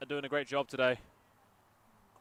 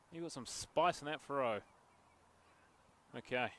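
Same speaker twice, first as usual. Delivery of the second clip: ordinary talk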